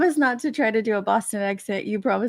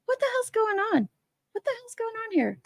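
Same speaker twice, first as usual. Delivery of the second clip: higher, incredulous question; mock disbelief; 11 semitones higher, 3 dB quieter than usual. Streamer reacts to celebrity gossip, high mock disbelief. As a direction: act baffled by the news. Gossip about rapper's lawsuit.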